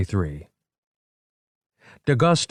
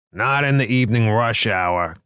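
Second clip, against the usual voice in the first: mildly raised, objecting call; 4 semitones higher, 4 dB louder than usual. A narrator's reading. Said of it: "called D'Augusta".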